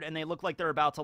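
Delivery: normal talking voice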